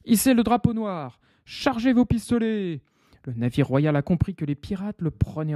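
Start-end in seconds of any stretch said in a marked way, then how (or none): 0.1-2.8 high commanding orders; barked orders. Acted. Captain ordering his pirates, high commands. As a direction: bark the orders at the crew. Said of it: "Le capitaine donne ses ordres".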